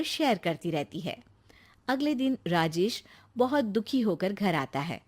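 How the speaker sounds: low, even storyteller voice; story narration. A narrator's reading. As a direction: draw the listener into the story. Narrator describes scene.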